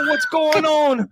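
high, drawn-out bad New York accent; hammed-up New York greeting, stretched out. Shown as a demonstration. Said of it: "what's your New York accent sound like?".

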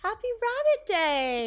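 high, slow sing-song greeting. Pretend. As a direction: stretch it out high and playful.

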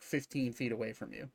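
ordinary delivery

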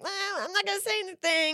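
high, quick mock-evasive voice; mocking imitation. Player mimicking everyone refusing to speak up, high and mocking. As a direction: put on a high voice to mock the reluctance. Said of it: "being like".

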